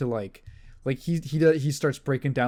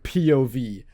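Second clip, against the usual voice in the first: ordinary talk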